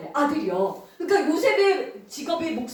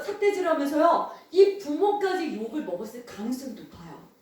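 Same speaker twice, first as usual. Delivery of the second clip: ordinary talk, nothing marked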